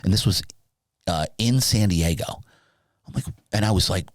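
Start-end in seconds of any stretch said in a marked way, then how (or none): none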